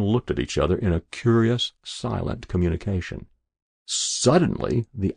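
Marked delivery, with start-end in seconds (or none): none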